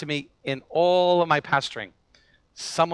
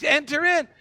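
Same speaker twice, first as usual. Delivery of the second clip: welcoming invitation; 9 semitones higher than usual. Retold or imitated. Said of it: "And he says".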